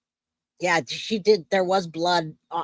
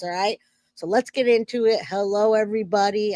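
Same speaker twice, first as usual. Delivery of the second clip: ordinary talk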